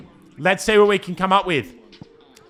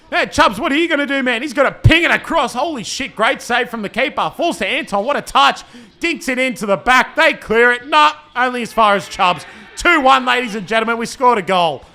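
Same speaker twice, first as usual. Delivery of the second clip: higher, louder rising excitement; excited commentary; 6 semitones higher, 4 dB louder than usual. Real, not acted. Owner commentating his team's goal to viewers, excited. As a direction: call the goal like a thrilled commentator.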